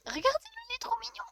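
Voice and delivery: very high squeaky coo; melting over something adorable, high-pitched; cute gushing squeal. Real, not acted.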